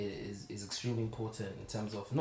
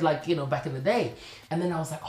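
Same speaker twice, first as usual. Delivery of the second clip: ordinary talk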